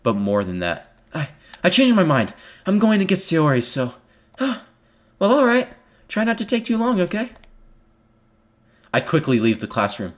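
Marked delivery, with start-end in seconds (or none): 1.1-7.3 higher, sweet schoolgirl voice, a little breathless; bright anime girl voicing, higher and lighter; Monika's voice. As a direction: play the cheerful club president. Voices Monika in visual novel.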